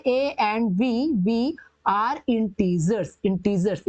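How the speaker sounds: drawn out very slowly, dropping low; slow dictation. Shown as a demonstration. Teacher writing the condition for students, slow dictation. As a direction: stretch each word as you write it.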